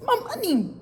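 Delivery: very high little wail; high-pitched mock lament; mock dismay. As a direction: whine it high, as a retold worry. Voices her dismay about pay.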